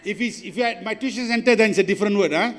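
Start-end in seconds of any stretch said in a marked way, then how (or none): none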